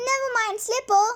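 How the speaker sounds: high, loud warning yell. A narrator's reading. Curly yelling to Dennis on the stuck scooter. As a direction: shout it over the noise. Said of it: "yelled Curly".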